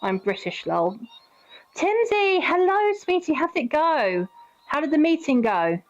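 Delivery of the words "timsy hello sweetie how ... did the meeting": cutesy greeting. Real, not acted. Greeting partner on stream.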